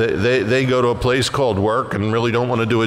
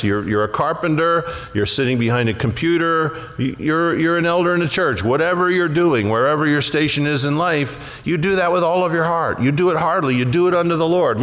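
rising preacher exhortation; 6 semitones higher than usual. Acted. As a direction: urge them on from the pulpit. Exhorting the congregation.

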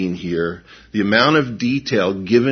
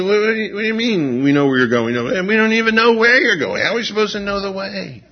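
Thomas, bewildered; 10 semitones higher, faster than usual. Retold or imitated. Thomas confused, asking Jesus where he is going. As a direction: ask it like someone totally lost. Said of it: "Thomas said".

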